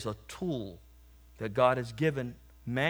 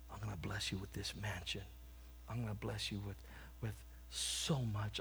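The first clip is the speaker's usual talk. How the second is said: soft, hushed and tender, much softer, like God promising riches; gentle voice of God; 3 semitones lower, 7 dB quieter than usual. Acted. God tenderly promising the old lady a mansion, hushed and gentle. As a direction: speak softly, lavishing a gift on her.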